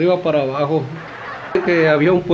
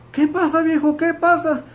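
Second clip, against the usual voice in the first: high-pitched alarmed questioning; mock panic, voice jumping way up; frightened wife; 13 semitones higher than usual. Spooked wife answers her shouting husband, high alarmed voice. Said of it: "la señora bien espantada le dice".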